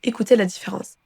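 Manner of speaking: ordinary talk, nothing marked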